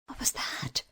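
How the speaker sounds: soft, timid whisper. A narrator's reading. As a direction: breathe it out, a little scared. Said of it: "she said timidly".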